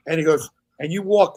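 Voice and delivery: normal talking voice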